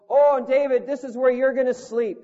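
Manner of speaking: hint of a cheery host showing the room; mock organizer. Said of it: "And he goes".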